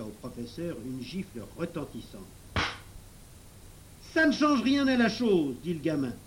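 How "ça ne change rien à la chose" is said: put-on high, loud boyish comeback; raised, high defiant voice; defiant retort. A narrator's reading. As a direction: throw it back at him defiantly. Boy retorts after slap.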